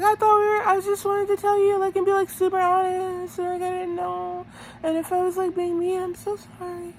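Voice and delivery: high-pitched mock apology, pleading; Teresa. Recapper mimicking a contestant saying sorry, high mock pleading. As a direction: plead in an exaggerated high little voice.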